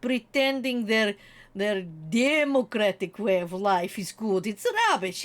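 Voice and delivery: put-on lightly Russian-accented scornful rant; mock Putin rant. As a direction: sneer at the West like a lightly voiced dictator. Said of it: "Just sitting there going".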